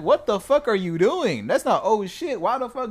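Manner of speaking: loud, high, angry yelling; furious at the guy behind the truck; angry shout. Retold or imitated. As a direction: chew him out for standing there. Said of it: "I'm turning around like".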